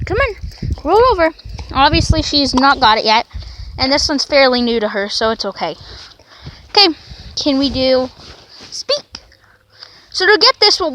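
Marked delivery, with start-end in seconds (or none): none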